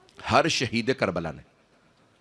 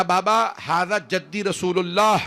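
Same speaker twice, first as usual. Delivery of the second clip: higher, drawn-out call to a father; raised, slow cry across a distance; 6 semitones higher, slower than usual. Retold or imitated. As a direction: imitate a son calling out to his father, stretching the words. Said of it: "تو فوراً آواز دی".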